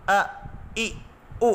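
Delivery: ا، ای، او cut off sharply with a jolt; jerked vowels. Shown as a demonstration. Teacher showing students the jerky reading to avoid. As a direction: jolt out ا، ای، او as the wrong way. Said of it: "جھٹکے سے مراد".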